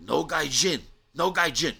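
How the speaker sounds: high, forceful shout of refusal; bouncer's shout. Retold or imitated. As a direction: shout it like a bouncer turning people away. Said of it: "And he said".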